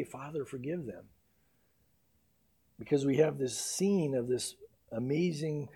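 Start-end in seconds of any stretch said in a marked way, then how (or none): 0.0-1.1 soft prayer. Acted. Jesus on the cross praying for his killers, hushed. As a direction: breathe it out as a dying plea. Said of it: "Maybe just hearing Jesus say".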